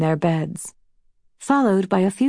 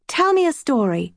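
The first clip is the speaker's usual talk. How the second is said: lightly voiced little sister, higher pitch; child's bedtime plea; 7 semitones higher than usual. A narrator's reading. Snow calling to her sister in the dark, lightly pleading. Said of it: "Snow called in the dark".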